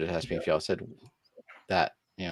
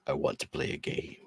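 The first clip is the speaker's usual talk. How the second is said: eerie whispered puppet voice; creepy Saw puppet whisper; Jigsaw voice; 8 dB quieter than usual. Retold or imitated.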